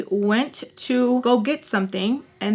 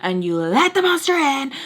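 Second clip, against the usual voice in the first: higher, louder retold accusation; pissed; 5 semitones higher, 4 dB louder than usual. Blaming him for letting the monster in, pissed. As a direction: push up into an angry reproach.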